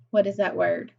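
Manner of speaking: ordinary talk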